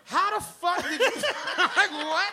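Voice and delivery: high, loud, disbelieving laugh; laughing shock. Retold or imitated. Stunned that the friend found him, laughing shock.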